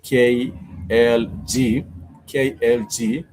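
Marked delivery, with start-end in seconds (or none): 0.0-1.8 K L G spelled out very slowly, letter by letter; K L G spelled out slowly and a bit louder. Shown as a demonstration. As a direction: drag each letter out like a bad example.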